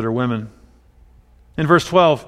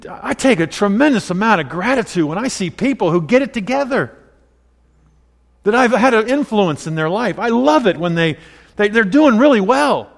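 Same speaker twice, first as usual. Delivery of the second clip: fervent preaching; 7 semitones higher than usual. Real, not acted. Preaching builds.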